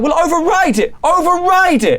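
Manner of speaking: very high and loud, demanding; urgent command. Acted. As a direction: Charlotte ordering the fix. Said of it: "she's like".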